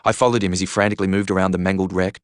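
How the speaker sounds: plain reading voice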